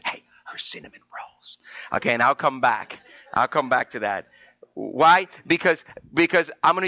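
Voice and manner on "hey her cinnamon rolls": breathy stage whisper to the pastor; hushed prompting whisper. Pretend. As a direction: whisper the reminder like a nudge. Wife whispering a reminder.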